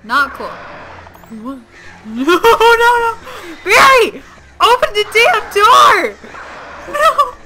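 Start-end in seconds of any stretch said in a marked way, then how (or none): 2.0-7.3 high, loud screams at the door; real panicked screaming. Real, not acted. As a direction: scream for Barry to open up. Locked out, begging Barry.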